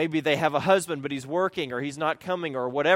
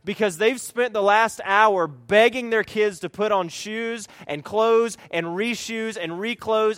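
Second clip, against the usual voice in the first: ordinary talk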